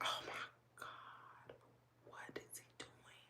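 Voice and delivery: hushed, drawn-out whisper to oneself; whispered dismay. Retold or imitated. Owner in the coop wondering what happened, slow whispered dismay. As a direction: whisper it slowly in mock alarm. Retells own alarmed aside in coop.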